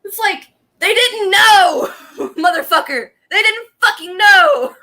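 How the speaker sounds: laughing at how obvious it is; exasperated outburst. Real, not acted. Ranting about old standards, exasperated laughing outburst.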